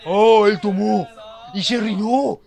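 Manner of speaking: loud, slow, stunned exclaim; surprised shout. Real, not acted. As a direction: blurt it out in shock. Surprised shout at drinking scene. (exclamación sorprendida y fuerte)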